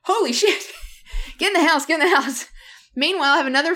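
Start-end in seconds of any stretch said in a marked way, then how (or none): 0.0-2.4 high-pitched, laughing mock panic; alarmed urging. Seeing rotation start with the son outside, mock-panicked urging. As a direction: retell hurrying everyone indoors in alarm.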